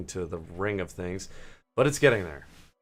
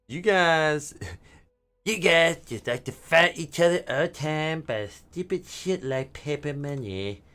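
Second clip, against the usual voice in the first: higher, odd alien voice, amused at humans; 7 semitones higher, 4 dB louder, slower than usual. Pretend. Alien mocking humans for fighting over money, odd higher voice. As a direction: talk like a bemused alien looking down on Earth. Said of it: "But they're probably saying".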